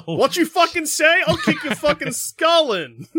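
high, slow hammed-up threat; shrill mock threat. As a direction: play a tough princess squaring up. Acting Peach threatening Bowser.

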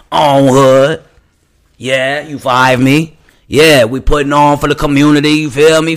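boisterous impression, pitched well above your own voice; C-Mac. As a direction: hype up your street and crew at full volume. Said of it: "to C-Mac".

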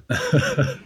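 quick amused chuckle; a real, short burst of laughter. Real, not acted. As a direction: laugh briefly at the teasing. Amused by the armband jibe.

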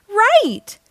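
high, loud burst of delight; Daisy thrilled her guess was right; excited cry. A narrator's reading. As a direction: pop the word out with glee. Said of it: "cries Daisy".

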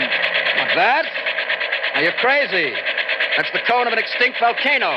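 shouting over engine. Acted. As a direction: yell to be heard in the helicopter. Shouting over helicopter noise.

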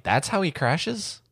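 high, drawn-out disbelieving question; incredulous. Retold or imitated. Host recalling the cheesy crash scene, incredulous. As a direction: question it as if it's ridiculous.

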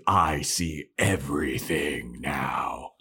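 low, slow mock-ominous intoning; spooky all-seeing voice. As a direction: draw it out like a joke phantom. Invisible Buffy spooking her sister, imagined.

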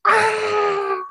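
high-pitched imitation of a startled scream; mock scream. Retelling the niece's shriek at the oily corpse, mock scream. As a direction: yelp it like the terrified niece. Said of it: "her niece is like".

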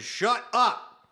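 loud, high imitation of an angry order; retold cop's shout. Cop silencing a ranting suspect, shouted in imitation.

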